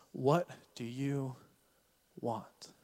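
slow and hushed, "want" set apart; slow, gentle question. Acted. Jesus asking Bartimaeus on the roadside, slow gentle question. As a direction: ask softly, pausing before the last word.

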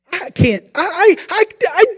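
high, stuttering protest; stammering panic. Acted. Gideon stammering to the Lord, high and panicked. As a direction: trip over your own words in fright.

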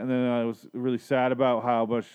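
ordinary delivery

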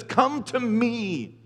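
very slow, drawn-out come to me; slow invitation. Shown as a demonstration. Preacher voicing Jesus' invitation, very slow. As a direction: stretch out "Come to me" word by word. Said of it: "Jesus says".

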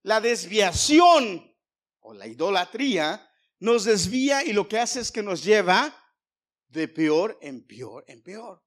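loud, high preacher's proclamation; full-force preaching. Acted. Preacher warning the congregation that idolatry leads astray, full-force preaching. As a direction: preach it out loud, stretching key words.

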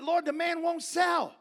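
high, drawn-out slow complaint; exasperated protest. Retold or imitated. Doctor Schuler protesting to the Lord, exasperated. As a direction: plead the case loudly upward.

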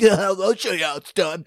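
imitating bros jeering at a pal; mock him like a cocky teammate; put-on taunting bro voice. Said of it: "like, mocking him, and they're like".

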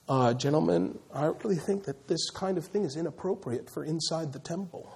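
hushed mock courtesy; mock polite. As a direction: gently object like a timid gentleman. Mock overly polite Jesus.

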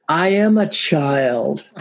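put-on crying child. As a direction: draw it out like an indignant kid. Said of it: "crying and, and basically being like".

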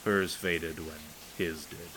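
ordinary delivery, nothing marked

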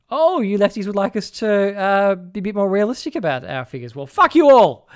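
high, loud mock-indignant rant; outraged conservatives. Conservatives snapping back at lefties, loud mock outrage. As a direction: rail at the lefties in a put-on outrage. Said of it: "that immediately makes conservatives go".

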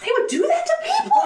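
high, fast, laughing disbelief. Retold or imitated. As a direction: squeal it through laughter. Laughing at naive past self.

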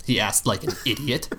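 ordinary delivery, nothing marked

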